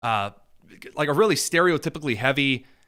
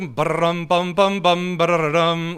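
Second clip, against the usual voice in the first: humming a dramatic beat; bum-bum it like a cheesy soundtrack; mock dramatic drumroll; much slower than usual. Commentator humming mock dramatic music.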